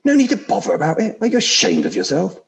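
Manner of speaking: the ghost shrieks in disbelief; outraged ghost. Acted. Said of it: "I'll try vaguely to put on different voices".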